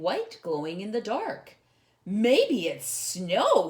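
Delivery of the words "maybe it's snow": slow, wondering and louder; excited. Acted. Corduroy thinks he's found snow, excited wonder. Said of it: "he said excitedly".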